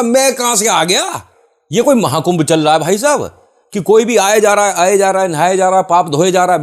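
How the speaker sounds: mock outrage. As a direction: ham up the indignation at the caller. Narrator retells his outraged reply. (ऊँची आवाज़ में नाटकीय हैरानी)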